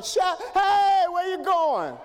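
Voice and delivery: sharp, loud, high angry shout; huffing holler. Retold or imitated. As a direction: bark at grown folk to sit down. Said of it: "I'm huffing".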